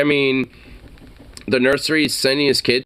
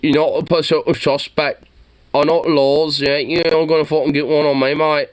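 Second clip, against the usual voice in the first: mock British accent; 6 semitones higher than usual. Streamer mocking the man on the doorstep in a British accent. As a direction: parrot the homeowner's British accent with a sneer. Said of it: "oh god, the fucking accent".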